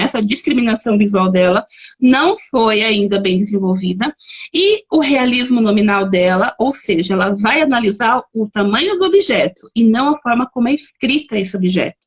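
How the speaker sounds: ordinary talk, nothing marked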